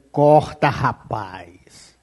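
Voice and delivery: low, soft, almost muttered warning; quiet warning. Real, not acted. Preacher warning a man to cut ties, hushed and grave. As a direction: say it under your breath, grave and low.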